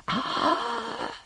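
very high, soft anguished cry; thin high wail of alarm. A narrator's reading. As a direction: let out a weak, high cry. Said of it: "he cried out".

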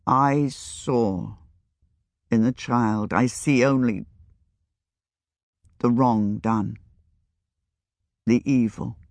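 deep, hushed and heavy; speaking very low. A narrator's reading. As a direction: keep it low and grave. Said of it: "speaking very low".

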